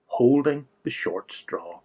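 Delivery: ordinary delivery, nothing marked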